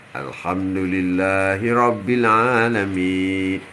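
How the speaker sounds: drawn-out, low chanted recitation; slow Quranic recitation. Acted. Preacher reciting to the congregation, slow chant. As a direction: intone the verse slowly and reverently.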